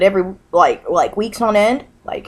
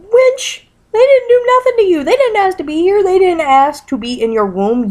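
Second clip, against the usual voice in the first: much higher and louder, a furious cry; indignant outburst; 10 semitones higher, 4 dB louder than usual. Real, not acted. Ranting vlogger yells at mothers who blame kids, outraged shout. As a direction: blast the word Wench and keep it up.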